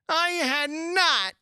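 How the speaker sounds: high, loud, drawn-out protest; indignant denial. A narrator's reading. Mouse contradicts Alice, high and indignant. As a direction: cry out in offence.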